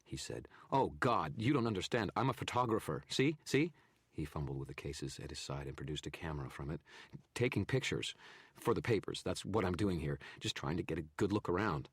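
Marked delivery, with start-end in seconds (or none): 0.7-3.7 higher, louder, babbling photographer; a cornered man talking fast to save himself; nervous photographer. A narrator's reading. Narrator voices frightened photographer. 7.3-11.9 higher, louder, babbling photographer; a cornered man talking fast to save himself; nervous photographer. A narrator's reading. Narrator voices photographer.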